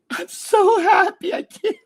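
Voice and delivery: squeaky high mocking voice, giggling through it; mock a giddy TikTok freak, laughing; mocking giggly freak. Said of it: "every freak that's laughing about that shit".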